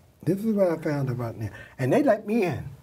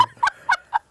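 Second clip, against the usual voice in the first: laugh; 6 dB louder than usual. Real, not acted. Laughing at playing dumb.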